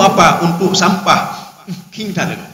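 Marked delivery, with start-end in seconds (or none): 1.9-2.4 "King tak lego" said softly in Acehnese; Acehnese phrase. Shown as a demonstration. Preacher showing the congregation a local phrase, said softly. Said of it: "sampah itu king tak lego".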